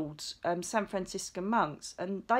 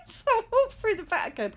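laughing through the words, pitched up and louder; 6 dB louder than usual. Real, not acted. Telling the viewers about the Vatican, laughing. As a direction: giggle it out as you go. Laughing at her own story.

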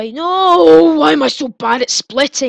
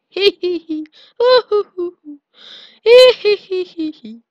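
high cackling hee hee; mischievous cackle; 5 semitones higher, 5 dB louder than usual. Acted. Player cackles at the cell that failed to catch him, impish cackle. Mocking giggle at opponent.

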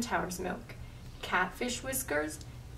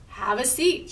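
louder cheerful call; shouting; 3 semitones higher, 6 dB louder than usual. Acted. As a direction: call out the invite. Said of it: "shouted Little Witch".

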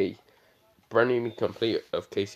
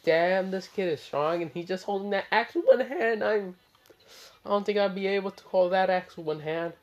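put-on a boxer's voice, clearly higher, amazed at the kid; awed boxer; 8 semitones higher than usual. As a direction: play the stunned boxer, pitched up. Boxer amazed at kid's strength.

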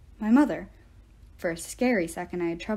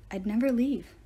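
ordinary delivery

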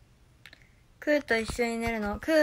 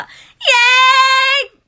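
very high, loud, stretched yay; hyped cheer; 13 semitones higher, 15 dB louder, much slower than usual. Shown as a demonstration. Showing chat her hyped-up tension with a stretched cheer. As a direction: scream it like a hype idol.

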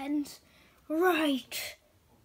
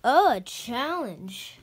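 gloating villain; 2 semitones lower, 7 dB louder, slower than usual. Acted. Grandmaster accepts the fight.

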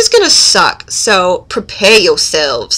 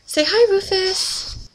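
soft, high, drawn-out coaxing; coaxing pet talk; 9 semitones higher, 6 dB quieter than usual. Real, not acted. Asking the dog to say hi, high soft pet talk. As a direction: sing it softly up high to a pet.

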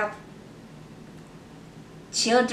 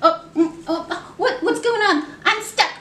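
higher startled puppet voice; puppet gets stuck; 6 semitones higher than usual. Acted. Puppet caught in the book, higher surprised fluster. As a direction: sound suddenly stuck and confused.

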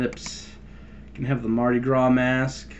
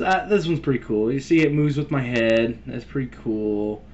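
usual speaking voice

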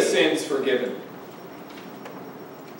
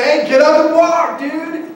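much higher, louder call-out; casual mock command; 9 semitones higher, 3 dB louder than usual. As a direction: breezily order someone up, voice pitched high. Mimes ordering a paralytic to walk.

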